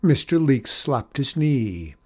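ordinary delivery

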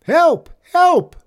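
high, loud shout for help; panicked shout. A narrator's reading. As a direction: shout it out in alarm.

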